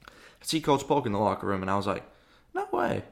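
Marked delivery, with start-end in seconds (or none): 2.5-3.0 high squeaky disbelief; retold gasp of amazement in falsetto; tiny high-pitched voice; amazed reaction.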